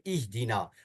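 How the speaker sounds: इहदीना begun with a short "i" vowel; initial short i. Shown as a demonstration. Teacher shows students the correct reading, इहदीना with initial "i". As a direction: say इहदीना opening on "ih". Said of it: "क्या पढ़ेंगे?".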